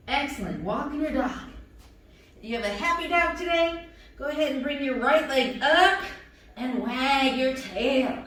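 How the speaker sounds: usual speaking voice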